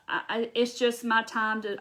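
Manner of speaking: ordinary talk, nothing marked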